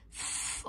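"ف-" as pure voiceless breath, no vowel; blown f sound. Shown as a demonstration. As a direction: blow a long, soft fff for "ف-". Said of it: "انفخ بقى كده". ("ف-" نفخ من غير حركة)

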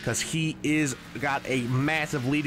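ordinary delivery

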